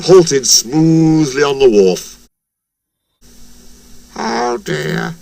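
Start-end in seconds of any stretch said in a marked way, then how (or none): 4.1-5.1 soft, raised, dismayed groan. A narrator's reading. Percy fears he lost, soft groan. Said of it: "groaned Percy".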